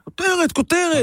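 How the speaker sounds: high, drawn-out cry of a headline; triumphant proclaiming. Real, not acted. Host insisting to a doubting colleague that it's a headline, triumphant proclaiming. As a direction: proclaim it like a big scoop. Mock-announcing a scoop over doubts.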